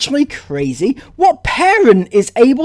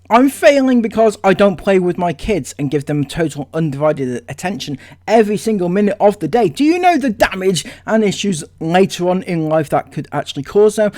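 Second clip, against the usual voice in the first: quoting her back; 3 semitones lower, faster than usual. Retold or imitated. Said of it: "she says".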